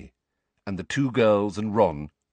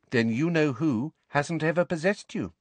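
matter-of-fact, higher-pitched verdict; simply stated; 8 semitones higher than usual. A narrator's reading. Ginny reassures Harry he is not possessed, stated simply. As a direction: state it simply, without fuss. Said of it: "said Ginny simply".

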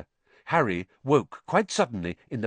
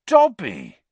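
surprised recognition; 5 semitones higher, 8 dB louder than usual. Acted. Harry recognises the elf.

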